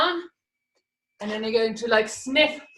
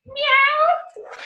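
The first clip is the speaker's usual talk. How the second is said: very high miaow; high-pitched cat cry; cat meow; 12 semitones higher than usual. Shown as a demonstration. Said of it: "into a cat again".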